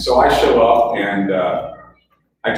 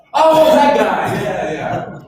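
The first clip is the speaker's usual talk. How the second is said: surprised recognition; 10 semitones higher, 5 dB louder, much slower than usual. Retold or imitated. Young coworkers recognize James Caan.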